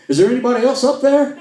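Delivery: high, loud call for someone else; mock desperate shout. The man on the cliff shouts his punchline skyward, hammed-up loud. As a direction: yell upward, hoping for a better answer. Man's punchline, calling upward.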